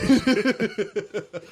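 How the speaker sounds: high loud burst of laughter; a high, loud real laugh. Real, not acted. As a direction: crack up at your own joke. Laughs at own joke.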